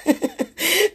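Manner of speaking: short burst of real laughter. Real, not acted. Laughing after own insult, short real laugh. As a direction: break into a quick giggle.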